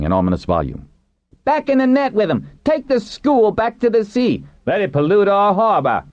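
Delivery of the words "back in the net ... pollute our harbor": high-pitched mob cries; angry crowd shout. A narrator's reading. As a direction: call for punishment from the crowd.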